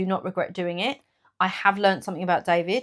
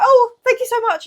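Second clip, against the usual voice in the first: much higher and louder, gushing thanks; grateful gushing; 9 dB louder than usual. Retold or imitated. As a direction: thank her with over-the-top delight. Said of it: "And I was like".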